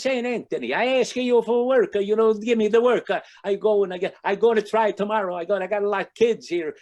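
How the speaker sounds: put-on high, loud comic Italian accent. Jobless Italian father pleading with Saint Anthony, Italian accent. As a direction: plead loudly as a hammed-up Italian guy. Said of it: "it's a little Italian guy".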